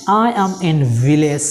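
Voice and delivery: very slow, carefully spaced words; dictation-slow teacher read; slow model sentence. Shown as a demonstration. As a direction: say it slowly so learners can follow. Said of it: "teacher models the English sentence".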